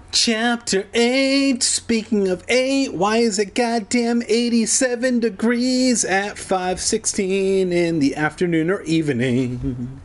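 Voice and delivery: hammed-up chapter heading voice; mock chapter announcement. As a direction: intone it high and long, easing down. Mock grand chapter announcement running on.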